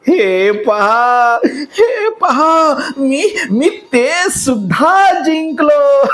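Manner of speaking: very high, slow, laughing through the words; a villain giddy with victory; cackling gloat. Acted. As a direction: laugh and crow at once. Shakuni cackling over win.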